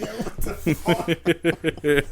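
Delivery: drawn-out, high, laughing yo what; slow laughing disbelief. Retold or imitated. Reacting to the brother's fall, slow and laughing. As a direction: stretch it out through laughter.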